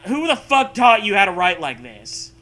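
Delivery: ordinary delivery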